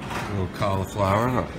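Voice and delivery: ordinary delivery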